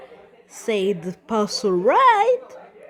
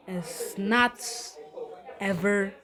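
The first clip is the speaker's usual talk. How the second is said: ordinary delivery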